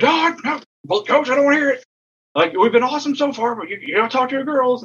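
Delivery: high, insistent mock brush-off; dismissive umpire. Umpire refuses to hear argument.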